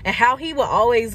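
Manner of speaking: normal talking voice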